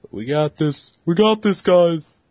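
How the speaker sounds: high, loud excited hipster; hammy cheerleading hipster. Retold or imitated. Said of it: "It's not a very hipster voice".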